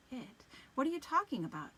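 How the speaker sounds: sharp startled question. Acted. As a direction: blurt it out in alarm. Startled, confused reply.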